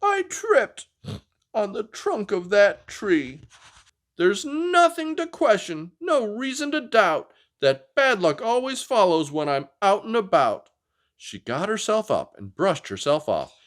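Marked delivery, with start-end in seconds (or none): 0.0-3.4 put-on high, slow grumpy dinosaur girl; a cross little dinosaur after a fall; snorting Anda. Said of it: "Anda snorted". 4.2-10.7 put-on high, sulky dinosaur girl; a pouting little dinosaur; grumpy Anda. Anda complaining in story.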